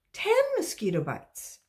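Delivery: put-on high, loud child's answer; eager child. A pupil answering the teacher, high and loud. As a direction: pipe up with the answer.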